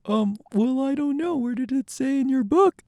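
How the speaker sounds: high, unsure asking; timid high fan question. Acted. Fan timidly asks Jung where it says that. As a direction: ask meekly.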